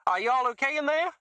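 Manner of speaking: put-on a congresswoman with a thick Southern accent. A narrator's reading. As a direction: fake Mississippi accent, high and loud. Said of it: "Her southern accent was thick".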